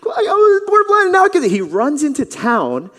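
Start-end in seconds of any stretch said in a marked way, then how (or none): 0.0-1.5 high, fast, crying with joy; tearful joy. Acted. Blind-born man telling his new sight, crying with joy. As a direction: sob it out overjoyed.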